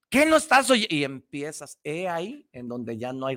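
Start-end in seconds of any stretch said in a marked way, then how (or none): 0.1-0.9 put-on higher, exasperated parent snapping at the kid; mock scolding parent. Parent losing patience with child glued to TV, exasperated snap. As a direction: play the fed-up parent cutting the child off.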